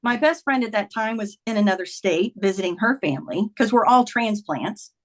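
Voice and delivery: ordinary talk, nothing marked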